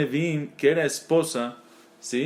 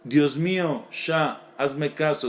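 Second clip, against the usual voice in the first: ordinary talk, nothing marked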